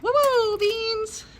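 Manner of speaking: high, loud excited woo-woo. Real, not acted. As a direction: cheer the dog on in a squealing voice. Mimicking excited dog.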